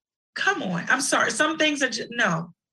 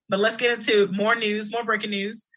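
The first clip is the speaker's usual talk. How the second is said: usual speaking voice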